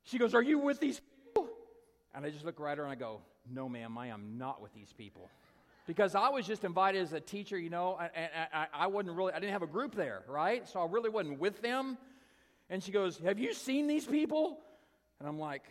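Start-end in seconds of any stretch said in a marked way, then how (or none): 0.3-1.5 high, loud demand; acted outburst, pitched way up; urgent confrontation. Retold lady confronts him again. 3.5-5.3 low, soft, measured denial; lowered, quietly firm answer; solemn denial. Retold or imitated. His retold guarded denial to her. 13.2-14.6 high, loud woman's voice; imitated high-pitched, insistent question; urgent questioning. Retold lady questions him urgently.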